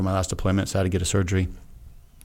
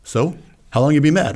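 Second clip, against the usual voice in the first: higher, louder playful challenge; teasing challenge; 6 semitones higher, 4 dB louder than usual. Retold or imitated. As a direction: poke at him playfully. Friend teasing back.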